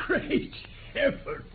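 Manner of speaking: a stunned, whispered gasp of disbelief; the words barely leave his mouth; breathless astonishment. Acted. Lord Cantlemere finds the stolen stone in his own pocket, whispering in shock.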